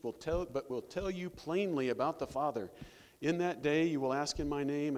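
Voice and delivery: loud, high preaching read; sermon reading voice; preaching scripture. Acted. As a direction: proclaim the verses to the congregation. Pastor reads scripture aloud.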